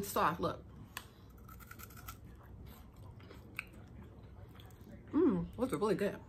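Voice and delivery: chewing while talking; mouth full. Real, not acted. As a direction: food in mouth. Seen 2: bites and chews puffs before and at start, then talks.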